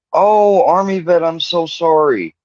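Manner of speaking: high-pitched, sorrowful "oh" easing down; tender condolence, pitched well up; real heartfelt sympathy. Real, not acted. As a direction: pour out sympathy to someone grieving. Condolences to bereaved viewer in chat.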